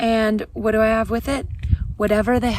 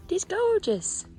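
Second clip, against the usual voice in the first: awed; 10 semitones higher, slower than usual. Real, not acted. Admiring view.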